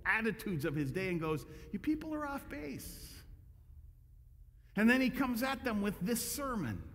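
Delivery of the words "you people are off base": higher, softer blunt dismissal; Jesus telling religious leaders they're wrong; lightly voiced Jesus. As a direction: brush them off bluntly.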